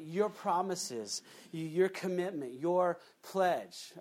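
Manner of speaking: usual speaking voice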